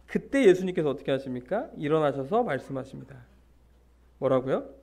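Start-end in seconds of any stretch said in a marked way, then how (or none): none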